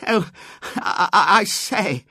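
high, stammering gasp. A narrator's reading. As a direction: splutter in shock, breaking up the words. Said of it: "gasped Robert".

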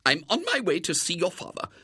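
high-pitched, grimly determined lady. A narrator's reading. Woman on her way to William's father, grimly. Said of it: "she said grimly".

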